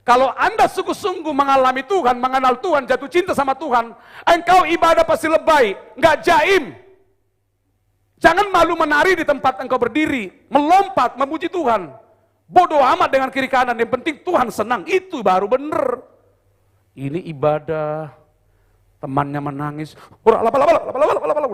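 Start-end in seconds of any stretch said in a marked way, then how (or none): 0.1-16.0 high, loud revival preaching; a pastor urging the church to worship without shame; fiery preaching. Acted. Preaching at full force. 20.3-21.5 higher, louder babbling with a laugh; a pastor laughingly mimicking a worshipper in tongues; mock tongues. Friend crying out in worship.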